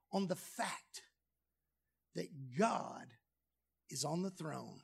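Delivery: quiet. Real, not acted. Quiet solemn preaching point.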